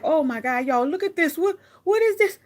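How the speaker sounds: rising to a high, loud "What, what is this?"; retold shock at ruined shoes, going high; shocked dismay. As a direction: squeal in disbelief at the ruined shoe. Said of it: "I was like".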